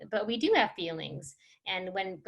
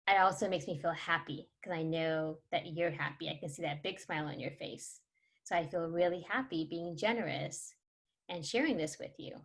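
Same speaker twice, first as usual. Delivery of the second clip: put-on soft, warm parent confiding in a child; role-played quiet, sincere affection; sharing feelings tenderly; 3 semitones lower, 7 dB quieter than usual. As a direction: tell a child gently how you feel.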